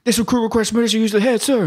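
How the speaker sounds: high, fast formal recruit voice; recruit requesting. Retold or imitated. Recruit asking the drill instructor, high and stiff. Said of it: "And he's like".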